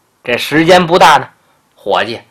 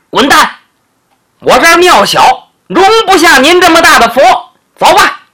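angry firing; 6 semitones higher than usual. Acted. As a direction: tell him to pack up and get lost. Shopkeeper fires him angrily.

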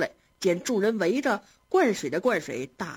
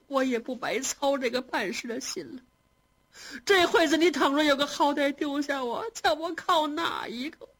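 lamenting reproach; 7 semitones higher than usual. A narrator's reading. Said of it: "如此数落着".

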